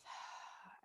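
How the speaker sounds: soft, breath-only sigh out the mouth; audible sigh. Shown as a demonstration. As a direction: sigh out through the mouth, letting it go. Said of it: "we're gonna sigh out the mouth".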